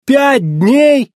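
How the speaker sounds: high, appalled echo question; horrified. A narrator's reading. McQueen learns how long the job takes, horrified. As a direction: repeat it in horror, pitch shooting up. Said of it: "ужаснулся Маккуин".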